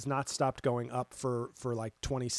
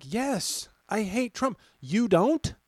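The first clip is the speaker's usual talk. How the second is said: higher emphatic answer; emphatic retort; 8 semitones higher, 5 dB louder, slower than usual. Retold or imitated. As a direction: say it proudly, pitched up. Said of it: "And I'm like".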